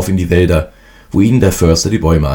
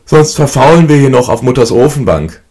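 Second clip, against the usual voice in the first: higher and louder, lazy by the stove; lazy eldest brother; 7 semitones higher, 5 dB louder than usual. A narrator's reading. Eldest brother rousing the others, lazy and loud. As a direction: nudge the brothers back to work. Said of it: "reckte sich gähnend".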